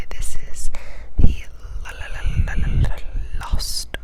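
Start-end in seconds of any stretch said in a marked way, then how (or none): 1.8-4.0 asmr trigger words. Acted.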